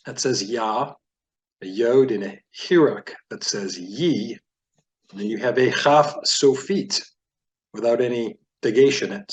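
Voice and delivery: ordinary talk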